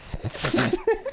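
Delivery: squealing laugh; high giggle burst. Real, not acted.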